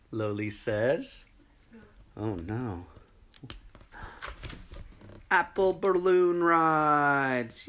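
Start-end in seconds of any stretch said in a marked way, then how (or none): 5.3-7.7 slow, high, loud shout; excited shout. Acted. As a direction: announce it with glee. Triumphant announcement.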